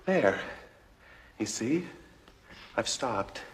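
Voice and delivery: soft, calming down; quiet. Real, not acted. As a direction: let it settle gently.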